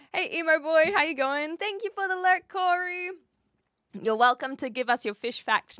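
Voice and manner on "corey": usual speaking voice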